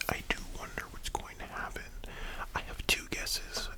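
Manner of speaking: ordinary delivery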